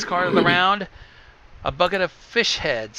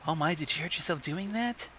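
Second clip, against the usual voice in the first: soft mock concern; 2 semitones higher, 9 dB quieter than usual. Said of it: "the horrible voice".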